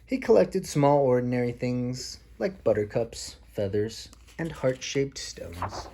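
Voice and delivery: put-on a parent reading a story to a small child; reading to children. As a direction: read it like a bedtime picture book. Parent reads picture book aloud.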